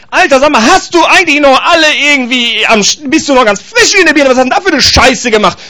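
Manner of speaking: high, loud acted rant; blunt telling-off. Someone bluntly telling Patrick off, acted angry outburst. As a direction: lay into him with mock outrage. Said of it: "ich brauch so eine Tacheles".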